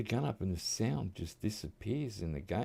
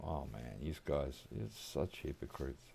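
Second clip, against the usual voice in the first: muttered thought; 4 semitones lower, 8 dB quieter than usual. Retold or imitated. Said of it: "just thought".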